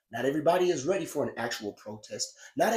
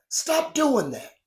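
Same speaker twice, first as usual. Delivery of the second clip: angry rant; 8 semitones higher, 6 dB louder, slower than usual. Real, not acted. Rant demanding organizers stop.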